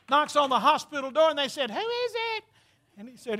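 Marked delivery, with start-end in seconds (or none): 1.7-2.4 ladies calling out. Retold or imitated. Voicing ladies behind door.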